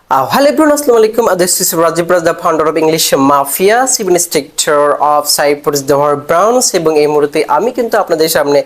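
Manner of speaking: ordinary delivery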